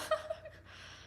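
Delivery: a short airy high laugh; amused high giggle; high breathy giggle. Real, not acted. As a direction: let a giggle slip out high. Laughs after red flag.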